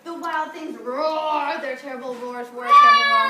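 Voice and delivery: a teacher's playful monster roar; roaring wild thing. Pretend. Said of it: "I didn't say roar".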